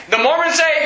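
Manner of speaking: ordinary talk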